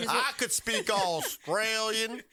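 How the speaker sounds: slow, high hammed-up Australian boast; mock Australian accent. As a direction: parody a terrible Australian accent. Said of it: "do an Australian accent".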